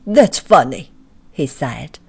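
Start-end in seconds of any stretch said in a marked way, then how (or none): 0.1-0.9 Mister Robinson's voice. A narrator's reading.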